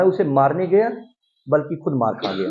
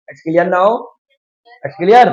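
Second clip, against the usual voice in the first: calling out; 3 semitones higher, 7 dB louder, much slower than usual. Real, not acted. Teacher checking class understood.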